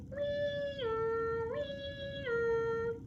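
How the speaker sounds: very high wailing siren; siren imitation. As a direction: howl like a passing siren. Siren passes.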